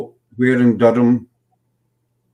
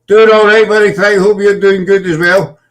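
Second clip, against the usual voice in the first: high, loud, cheery mate voice; hammed-up friendly pub greeting; chummy rugby fan, raised and bright; friendly bloke voice; 7 semitones higher, 5 dB louder than usual. Voicing friendly rugby players.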